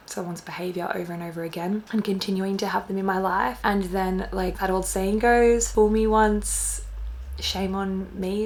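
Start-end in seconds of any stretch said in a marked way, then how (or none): 5.7-8.4 slow, drawn-out, groping for the words; unsure recalling. Real, not acted. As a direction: say it slowly, trying to remember. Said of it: "I can't remember the saying".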